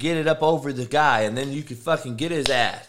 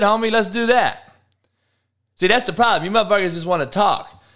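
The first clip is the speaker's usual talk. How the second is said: angry taunting; 5 semitones higher, 4 dB louder than usual. Real, not acted. Challenges heckler to bank bet.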